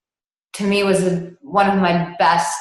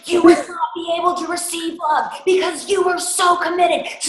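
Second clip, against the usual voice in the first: much higher, raised, cussing tirade; retold screaming rant; 12 semitones higher than usual. Coach tearing into a self-hating client, high furious rant. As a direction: unload on him full force, swearing. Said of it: "began screaming and cussing at him".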